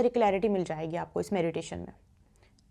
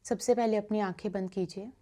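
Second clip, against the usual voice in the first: guided meditation; 2 semitones lower, 6 dB quieter than usual. Acted. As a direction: guide listeners gently into stillness, softening on repeated phrases. (धीमी, शांत ध्यान कराने वाली आवाज़)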